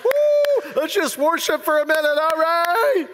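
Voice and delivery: high, loud woo, then eager talk; rally everyone to worship; excited disciples. Retold or imitated. Disciples urging each other to worship, excited high voice. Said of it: "They were probably just like".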